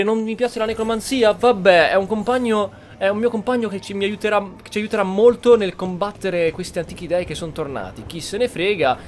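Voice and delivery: ordinary talk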